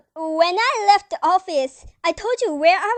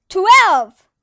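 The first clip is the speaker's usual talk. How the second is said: triumphant loud shout; loud exclaim; 2 semitones higher, 11 dB louder than usual. Acted. Aunt shouting the new total in triumph, loud. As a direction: blurt the number out proudly.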